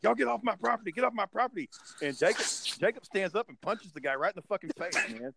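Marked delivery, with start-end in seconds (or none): 0.0-1.7 high, yelled order to get off his land; angry old dad. Retold or imitated. Dad yelling at the kids to leave his yard. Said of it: "he's like".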